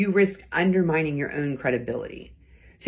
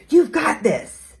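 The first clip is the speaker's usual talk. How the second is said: slow, higher and louder encouragement; reassuring; 9 semitones higher, 8 dB louder, slower than usual. Shown as a demonstration. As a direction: back them up with steady confidence. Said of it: "That's more casual".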